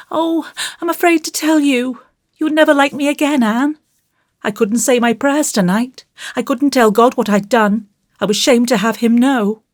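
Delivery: put-on high, plaintive child's voice; troubled child, pitched high; anxious little boy. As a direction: confess like a frightened small boy. Voices Davy, afraid to confess.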